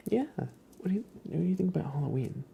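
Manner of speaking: high, quick cooing question; high pet talk. Real, not acted. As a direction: ask the cat again, lifted and cooing. Asking the cat again.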